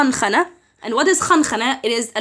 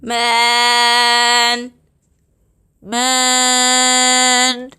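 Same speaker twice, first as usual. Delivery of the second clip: pinching nose; much slower than usual. Shown as a demonstration. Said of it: "when I hold my nose".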